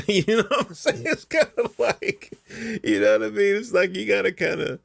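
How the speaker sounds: high, laughing asides. Real, not acted. Man on camera laughing at the absurdity, high giggly voice. Laughing at his own point.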